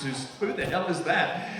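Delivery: usual speaking voice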